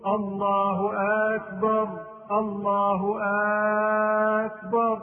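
chanted, with a long aa opening "أكبر"; wrong long hamza. Shown as a demonstration. Teacher shows the faulty call, "أكبر" stretched at its start. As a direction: intone it and hold the first vowel of "أكبر". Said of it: "هذا خطأ".

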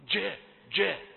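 "جاء" with a hard stopped dj; firm jeem. Shown as a demonstration. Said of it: "لاحظ أن الجيم حرف شديد". (جيم شديدة محبوسة في "جاء")

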